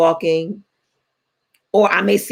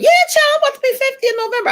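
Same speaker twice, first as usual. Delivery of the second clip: playful boast; 3 dB louder, faster than usual. Real, not acted. Playful brag about her age.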